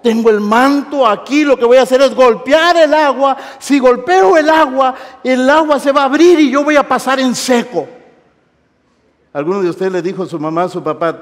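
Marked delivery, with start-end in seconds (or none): 0.0-7.9 loud, raised preacher's voice acting the prophet; building, high-pitched proclamation; bold declaration. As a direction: proclaim it at full voice. Pero dijo.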